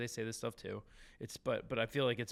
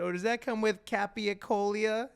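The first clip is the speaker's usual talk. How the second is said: lightly imitating mom, pitched higher; a higher, mild take on an asking mom; mom quote; 7 semitones higher than usual. Mom asking the deli guys about a sandwich, lightly imitated.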